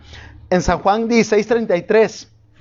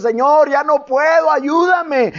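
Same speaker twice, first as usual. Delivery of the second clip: higher, pleading cry to the Lord; weary plea; 5 semitones higher, slower than usual. Retold or imitated. Preacher voicing a tired believer, pleading. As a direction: beg the Lord, worn out.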